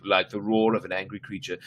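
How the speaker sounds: ordinary delivery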